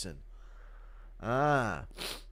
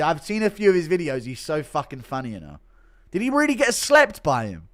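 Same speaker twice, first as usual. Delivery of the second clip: ordinary talk, nothing marked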